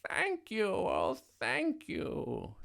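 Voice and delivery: high, gushing witch thanks; grateful storybook witch crying out. A narrator's reading. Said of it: "the grateful witch cried".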